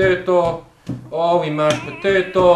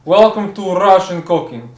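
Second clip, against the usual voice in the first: Russian TV chef with slow, deliberate delivery; comic Russian accent, drawn-out name; put-on Russian accent; 4 dB louder than usual. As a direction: introduce a cooking show in a thick Russian accent. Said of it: "It sounded Italian".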